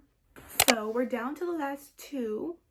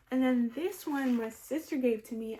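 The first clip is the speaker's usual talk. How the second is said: ordinary delivery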